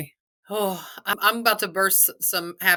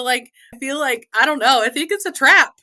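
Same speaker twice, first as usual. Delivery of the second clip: ordinary talk, nothing marked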